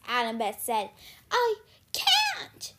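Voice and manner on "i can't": very high, loud wail; desperate protest. A narrator's reading. Circe protests under threat.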